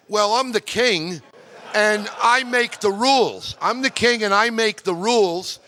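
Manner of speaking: hammed-up regal pride, savouring each word; mock grand declaration; proud royal boast. Jordan's king boasting about call sign.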